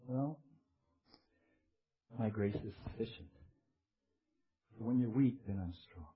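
low, hushed reassurance; gentle, quiet voice of God; calm divine reply. Acted. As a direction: answer softly and deep, with pauses. Voicing God's calm reply.

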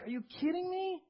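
high, incredulous squeal without extra volume; mock exasperation. Preacher voices someone looking at their troubles, mock exasperation. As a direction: push the disbelief right up in pitch. Acted reaction to life's troubles.

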